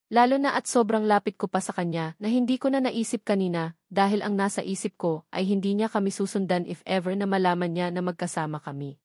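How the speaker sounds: ordinary delivery, nothing marked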